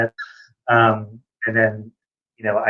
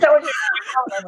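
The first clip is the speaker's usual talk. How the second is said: high, slow laughing outburst; laughing shout; much slower than usual. Real, not acted. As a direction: burst out laughing as it dawns. Laughing at the mix-up.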